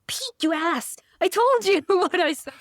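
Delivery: high-pitched laughing indignation; comic told-you-so outburst; mock outrage. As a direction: scold a friend in playful exasperation. Outburst at podcast she retells.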